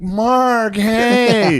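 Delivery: high, loud fawning hello; gushing greeting. Retold or imitated. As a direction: gush over Mark. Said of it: "he's like".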